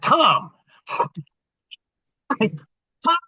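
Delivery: mock exasperated appeal. Calling Tom to attention after his repeated question, exasperated. Retells losing patience with Tom.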